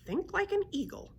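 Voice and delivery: high-pitched creature echo; griffin voice. A narrator's reading. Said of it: "repeated the griffin".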